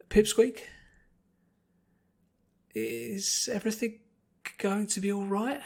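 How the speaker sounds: high, pleading little colt; a scared child hoping for comfort; hopeful, desperate colt. Acted. Frightened colt asking Twilight for reassurance, high and pleading. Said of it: "he asked. Hopeful. Desperate".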